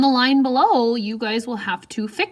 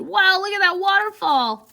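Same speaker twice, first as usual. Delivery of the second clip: high, bright amazed wow; excited exclaim; 9 semitones higher, 4 dB louder than usual. Shown as a demonstration. Teacher voicing a kid in awe at a waterfall, high-pitched. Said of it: "You notice how my voice changes when things have quotations".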